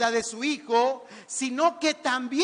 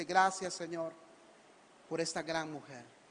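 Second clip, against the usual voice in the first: quiet grateful prayer; 7 semitones lower, 9 dB quieter than usual. Acted. Good son prays softly.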